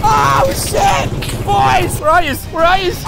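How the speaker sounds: high, loud alarmed shouts; panicked calling. Acted. Friend left behind seeks the boys, panicked calling. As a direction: call out frantically for the others.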